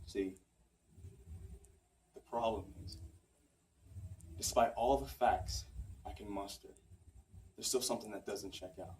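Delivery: low, soft and halting; hushed, heartfelt stage delivery; subdued confession. Acted. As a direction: confess love reluctantly, with long pauses. Monologue turns to reluctant love confession.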